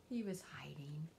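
put-on hushed whisper to the children. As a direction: whisper it like a little secret. Revealing the dinosaur softly.

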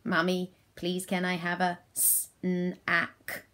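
slow monotone request, SNACK split into s, n, ack; child playing robot. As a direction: imitate a child asking in robot talk. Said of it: "guess the word that they're trying to say".

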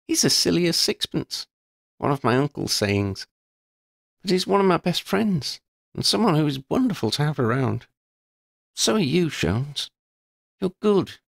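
put-on girl's voice, amused then serious; Lydia, wry schoolgirl. A narrator's reading. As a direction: tease her friend, then own up frankly. Lydia praises her friend.

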